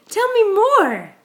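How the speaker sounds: high slow playful coax. Real, not acted. Parent coaxing baby to talk.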